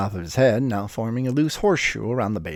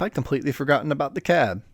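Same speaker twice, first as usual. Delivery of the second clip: ordinary delivery, nothing marked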